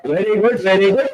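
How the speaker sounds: put-on high, excited kid-style cheering; group clapping cheer, Very good then Hey; childlike cheer. Shown as a demonstration. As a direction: cheer like a happy kid over a small win. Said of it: "Do that".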